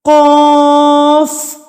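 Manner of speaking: slow sing-song Qaida recitation; reciting the alphabet slowly. Shown as a demonstration. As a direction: chant the letters one by one for the class. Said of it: "اسی طریقے سے دو حرکات کے برابر آپ کھینچ کے پڑھیں گے".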